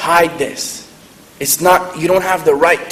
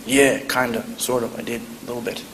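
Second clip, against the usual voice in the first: timid, fading softer and softer; scared mumble; 5 semitones lower, 8 dB quieter than usual. Retold or imitated. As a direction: shrink away while admitting it. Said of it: "I was scared".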